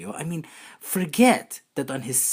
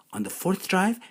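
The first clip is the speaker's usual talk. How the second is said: normal talking voice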